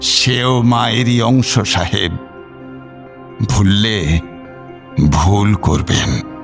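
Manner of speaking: slow, low, unruffled; calm reply. Acted. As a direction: answer the threat calmly, with weighty pauses. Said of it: "যথারীতি শান্তভাবেই দিয়ে যান তিনি". (শান্ত, ধীর, নিচু গলায়)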